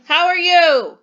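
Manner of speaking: loud, high yell with strong emotion. Shown as a demonstration. Teacher showing pupils a sentence with strong emotion, yelling. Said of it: "Am I yelling it or using strong emotion?".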